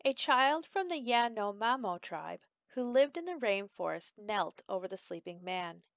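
ordinary delivery, nothing marked

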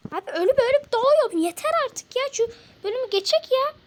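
annoyed loud rant; angry. Real, not acted. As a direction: scold in frustration. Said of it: "sana kızınca".

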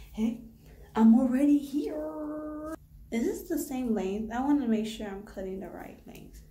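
ordinary talk, nothing marked

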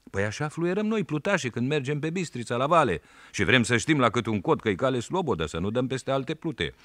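put-on a village boy sassing the policeman; boy Sandu answering the policeman, high and cheeky. A narrator's reading.

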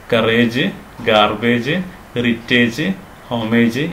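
കറേജ്, ഗാർബേജ്, ഹെറിറ്റേജ്, ഹോമേജ് all ending in long "-ayj"; common mispronunciations. Shown as a demonstration. Teacher listing what to avoid, "-ayj" on കറേജ്, ഗാർബേജ്, ഹെറിറ്റേജ്, ഹോമേജ്. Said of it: "ഇങ്ങനെയൊന്നും പറയരുത്".

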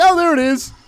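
high, loud burst of realisation; mock excited discovery. Player imagining them spotting the island at sunrise, mock excited. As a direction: exclaim it as a sudden mock discovery.

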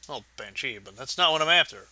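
ordinary talk, nothing marked

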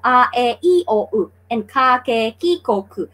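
"a, e, i, o, u, ka, ke, ki, ko, ku" as separate even syllables. Shown as a demonstration. Teacher showing newcomers how Japanese speaks, syllable by syllable. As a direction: recite each Japanese sound on its own beat, stepping down at the end. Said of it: "they speak like that".

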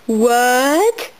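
ordinary delivery

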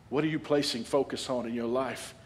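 usual speaking voice

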